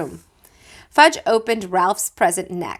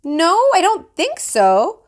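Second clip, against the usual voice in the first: lightly voiced grandmother, higher and slow; grandma checking; 10 semitones higher, 4 dB louder, slower than usual. A narrator's reading. Grandma checking her bitten hand, lightly voiced. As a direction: answer while inspecting the hand. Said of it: "Grandma said".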